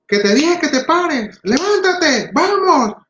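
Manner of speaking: a parent losing patience, pitch climbing; exasperated scolding parent. Acted. Parent scolding the child on the floor, climbing exasperated nagging.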